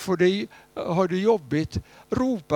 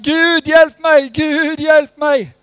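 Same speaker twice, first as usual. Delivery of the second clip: desperate shout for help, slow and high; loud slow plea, much higher; crying out; 12 semitones higher, 13 dB louder, slower than usual. Retold or imitated. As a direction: cry out to God as you slide off the roof. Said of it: "Och så ropade han".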